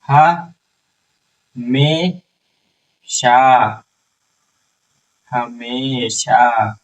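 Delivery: हमेशा very slow, long शा; teacher modelling हमेशा slowly; slow sounding out. Shown as a demonstration. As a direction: stretch हमेशा out for the class. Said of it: "श पर आ की मात्रा शा".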